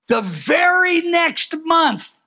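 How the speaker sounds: high, slow shouted opener; preacher's shout. Acted. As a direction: launch the next story with a sudden cry. Dramatic preaching build.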